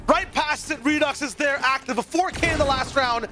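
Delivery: esports caster. Acted. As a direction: shout the winning play. Climactic call of final round.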